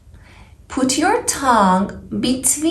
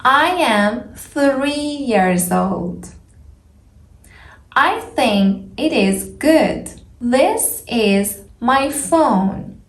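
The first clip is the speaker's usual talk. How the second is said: slow careful speech; slower than usual. Shown as a demonstration. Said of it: "Can you hear the differences".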